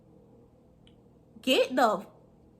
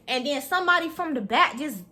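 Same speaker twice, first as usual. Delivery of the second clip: ordinary delivery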